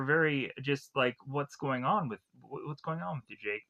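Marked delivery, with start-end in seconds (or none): 1.3-3.7 a touch of fear, trailing much softer; fearful. Retold or imitated. Frightened woman asking out-of-breath man, mildly fearful. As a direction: ask it half-scared, voice dropping away. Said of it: "at Jake very in fear".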